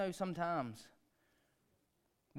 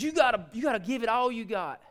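coaching dad; 8 semitones higher, 8 dB louder than usual. Retold or imitated. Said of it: "I said".

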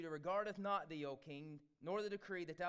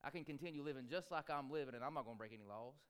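complacent shrug; 6 semitones lower, 5 dB quieter, faster than usual. Retold or imitated. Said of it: "would we all just be going".

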